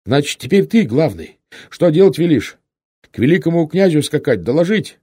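high, loud urgent questions; loud asking for orders. A narrator's reading. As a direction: call out for a command. Rider loudly asks for orders.

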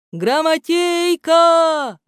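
distant call. Acted. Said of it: "послышалось издали".

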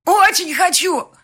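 very high, loud, slowed shriek; hysterical yell. Acted. Bride hysterically yelling at fiancé through the door. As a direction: scream it hysterically. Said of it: "истерично завопила я".

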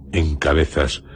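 usual speaking voice

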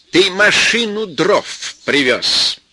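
mother-in-law shouting at Venya about firewood. Acted. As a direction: hurl the accusation, drawing it out. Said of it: "вернулась и закричала".

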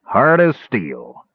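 slow, pleased dwarf drawl; grinning through the pain. A narrator's reading. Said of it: "grinning and shaking his hand".

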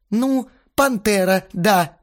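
high, slow, reluctant answer; grudging admission. A narrator's reading. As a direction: admit it with drawn-out reluctance. (нехотя, протяжно)

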